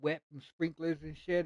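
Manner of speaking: usual speaking voice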